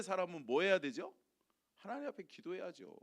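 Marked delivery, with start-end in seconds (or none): none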